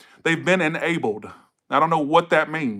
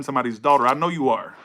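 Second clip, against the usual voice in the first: ordinary talk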